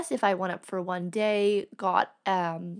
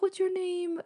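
higher, gentle mock voice; light imitation of the artist; 10 semitones higher, 3 dB quieter than usual. Star asking the fan her name, in a higher imitated voice. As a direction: lift it up, friendly and light.

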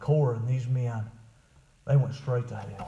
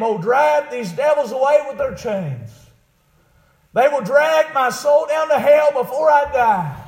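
loud, high retold plea; preached exclamation; 14 semitones higher, 12 dB louder, slower than usual. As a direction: exclaim it as a preacher voicing the dying. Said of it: "during his sickness he'd exclaim".

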